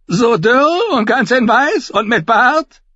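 high, loud, slow jovial knight; boisterous knight. Acted. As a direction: tease him like a brash soldier. Cousin Davin's booming teasing voice.

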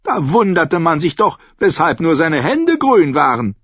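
high, loud voice of a mocking old noblewoman; a sly, tart old lady holding court; Olenna Tyrell. A narrator's reading. Olenna mocking a rival to Sansa, high and loud.

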